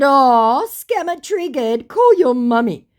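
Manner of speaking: high, drawn-out fake pity; loud playground jeer; mocking taunt. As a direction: coo it mockingly at a sore loser. Her taunt to the scammer.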